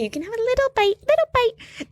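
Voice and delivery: high-pitched, quick cutesy coaxing; babying treat voice. Pretend. Offering listeners a little bite of F1, babying voice.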